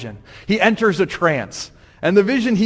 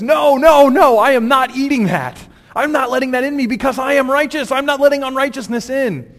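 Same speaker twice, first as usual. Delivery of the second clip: loud, higher, self-righteous protest; loud, higher indignant refusal; 8 semitones higher, 5 dB louder than usual. Acted. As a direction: shout him down, sure you're right. Peter refuses three times, indignant.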